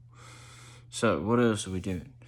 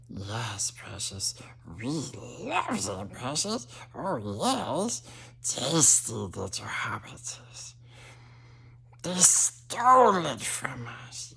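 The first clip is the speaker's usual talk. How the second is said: creepy precious creature voice; 3 semitones higher, 4 dB louder, slower than usual. Pretend. Stoner host slipping into a creature guarding its precious, creepy and hissing. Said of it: "get stuck, you know, in the voice".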